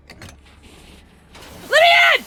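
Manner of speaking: high, slow, loud plea; desperate shout. Real, not acted. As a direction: yell to be let in. Desperate at door in game.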